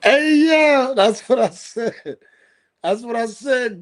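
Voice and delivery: hyped up agreement; excited high shout. Real, not acted. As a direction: crow it out in delight. Excited agreement, laughing hard.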